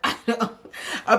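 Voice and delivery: laughing through the words. Real, not acted. Host laughing at the reality show drama. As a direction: giggle while talking.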